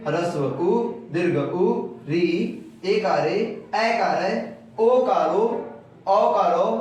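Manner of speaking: each sound named slowly and distinctly, as a teacher; careful recital. Shown as a demonstration. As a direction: recite the vowels one by one, precisely. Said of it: "अच्छे से उच्चारण करते हो तो ऐसे पढ़ा जाता है".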